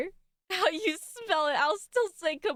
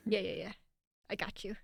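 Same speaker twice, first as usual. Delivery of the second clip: unvoiced, soft aside; hushed reassurance; whisper; 7 semitones lower, 8 dB quieter than usual. Real, not acted. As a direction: whisper so the read is not spoiled. Whispering during the read.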